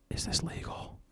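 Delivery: worried mock whisper; softly whispered imitation; imitated hushed question. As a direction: whisper it like a nervous accomplice. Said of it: "she gets all quiet".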